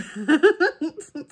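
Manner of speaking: loud helpless laugh. Real, not acted. Crafter laughing loud at her sticky fingers. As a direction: burst out laughing.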